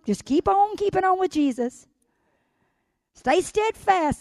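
rising preaching. Acted. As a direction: lift the church up. Preaching builds to full force.

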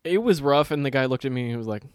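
ordinary talk

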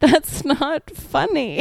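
high, drawn-out disbelief; incredulous objection. Real, not acted. Judging the joke amid laughter.

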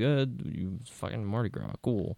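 soft, bored put-on voice; mumbled flat reply. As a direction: mutter it without interest. Imitating brother's flat reply.

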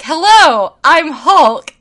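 put-on high, loud proud little-boy voice; a proud kid announcing he's Hulk; kid playing Hulk. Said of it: "and he's like".

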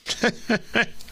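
quick scoffing laugh; a dry laugh at the idea; short laugh. Real, not acted. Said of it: "I laugh".

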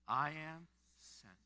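slow, each word drawn out; slow declaration. Shown as a demonstration. Pastor leading the congregation in repeating it, slowly. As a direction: say it slowly with weight. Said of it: "Can you say it with me right now?".

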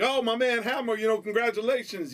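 radio DJ. Retold or imitated. Morning DJ greeting [name] on the air with hype. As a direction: greet the caller like an on-air host. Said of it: "[name]'s like".

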